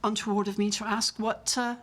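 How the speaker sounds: posh West End English; Jamie Saint Andrews. Acted. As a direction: chat politely in a posh West End accent. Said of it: "my posh West End accent".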